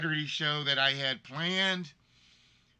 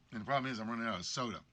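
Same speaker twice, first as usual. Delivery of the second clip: normal talking voice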